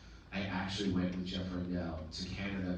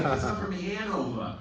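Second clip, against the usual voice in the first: higher-pitched mock Massachusetts woman; imitated Massachusetts accent; 9 semitones higher, 5 dB louder than usual. Auditioning woman explains her roots, imitated Massachusetts accent. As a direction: put on a high Massachusetts accent. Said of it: "How the fuck do you do that".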